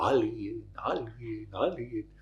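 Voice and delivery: fading echo repeating. Retold or imitated. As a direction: let it die away like an echo. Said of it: "Y el eco". (eco que se apaga)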